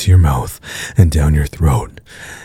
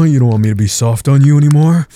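higher taunt; 7 semitones higher, faster than usual. Acted. Heated taunting.

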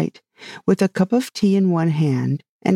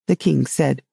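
plain reading voice

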